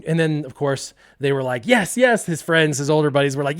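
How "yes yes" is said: high, loud urging; eager goading. Acted. Older friends urge the kid to retry, high and eager. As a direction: cheer him on eagerly.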